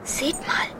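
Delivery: soft unvoiced whisper. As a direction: whisper it to the others. Secret search at the wall.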